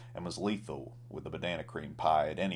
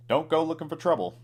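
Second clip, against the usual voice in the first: high, fast, louder barman warning; 10 semitones higher, 5 dB louder, faster than usual. A narrator's reading. Barman warns cowboy, high fast voice. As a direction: fire off the warning high and quick.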